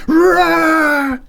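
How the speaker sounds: very high, loud roar. Acted. McX roaring at the rat as he flings the door open.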